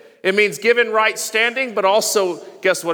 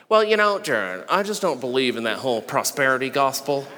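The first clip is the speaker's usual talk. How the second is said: lightly voiced skeptic, low and soft; doubting skeptic; 9 semitones lower, 5 dB quieter than usual. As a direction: mimic a doubter, half-mocking. Voicing a doubting churchgoer.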